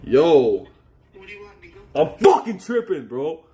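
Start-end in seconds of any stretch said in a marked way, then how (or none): 1.9-3.4 high, slow exasperated cry; agitated outburst. Real, not acted. Streamer realising he's in trouble, agitated outburst. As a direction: burst out high, stretching the swear. Exclaiming over his own panic.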